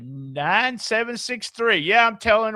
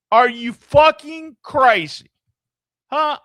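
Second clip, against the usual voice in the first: louder outraged disbelief; 5 semitones higher, 7 dB louder than usual. Real, not acted. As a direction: blast the fan for the claim. Outraged reaction to fan.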